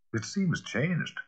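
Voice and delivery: slow, hushed unease; mild unease, drawn out; pale, shaken reply. A narrator's reading. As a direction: say it slowly, shaken. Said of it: "replied the lawyer, very pale".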